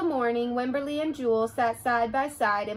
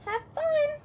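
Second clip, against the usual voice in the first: a much higher cheery grandma; grandma's voice. Acted. Grandma sending Wimberly off to school, higher and cheery. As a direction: sing out a bright goodbye. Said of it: "said Grandma".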